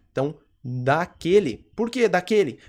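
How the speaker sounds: normal talking voice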